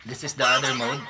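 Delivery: sped-up squeaky chipmunk effect. Shown as a demonstration. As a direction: chipmunk filter voice with a laugh. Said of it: "Boses Chipmunks".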